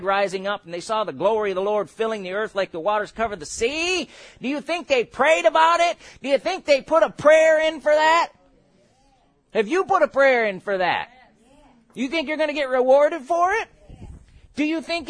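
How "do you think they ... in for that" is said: louder, higher rhetorical challenge; challenging. Real, not acted. Preacher challenging the congregation, higher and louder. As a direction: rise up and confront them.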